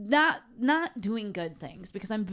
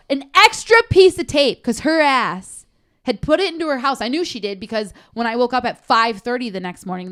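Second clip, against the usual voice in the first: angry outburst; 3 semitones higher, 9 dB louder than usual. Real, not acted. Furious retelling of the neighbor.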